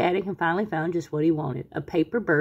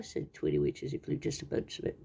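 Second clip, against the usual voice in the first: ordinary delivery, nothing marked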